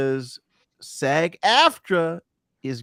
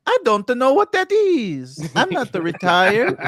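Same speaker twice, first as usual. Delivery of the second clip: high, loud cartoon plumber voice; Charles Martinet as Mario; 10 semitones higher, 8 dB louder than usual. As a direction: belt it out as an exuberant game mascot. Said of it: "that was Charles Martinet's version of a Mario ambassador".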